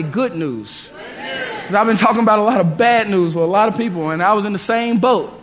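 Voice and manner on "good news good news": softer echo, nearly whispered; soft call and response. Shown as a demonstration. Preacher leading the church in echoing good news, softly. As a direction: let it fall soft and low. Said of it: "Everybody say".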